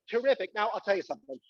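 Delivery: ordinary talk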